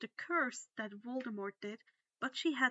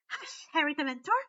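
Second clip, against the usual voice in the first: fast, loud elf scolding; sharp hush; 5 semitones higher, 9 dB louder, faster than usual. A narrator's reading. Winky scolds Harry, fast sharp elf voice. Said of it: "Winky glared at him".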